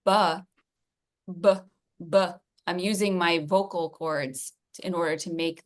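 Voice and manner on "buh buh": repeated voiced buh; voiced b demo. Shown as a demonstration. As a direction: sound out buh, buh so the voicing is felt. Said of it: "whereas if I make the sound".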